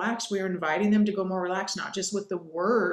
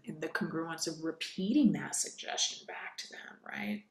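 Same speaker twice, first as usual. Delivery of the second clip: whisper; 3 semitones lower, 9 dB quieter than usual. Shown as a demonstration.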